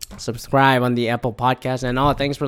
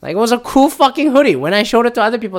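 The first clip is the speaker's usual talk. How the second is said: ordinary talk, nothing marked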